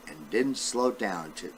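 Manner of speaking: ordinary delivery, nothing marked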